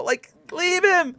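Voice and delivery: high, slow pleading "leave him"; whiny plea. Retold or imitated. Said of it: "And I'm just like".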